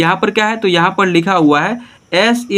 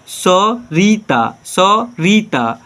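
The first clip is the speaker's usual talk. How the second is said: slow reading; much slower than usual. Shown as a demonstration. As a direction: draw the name out very slowly. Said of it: "teacher reads name slowly". (बहुत धीरे, खींचकर पढ़ना)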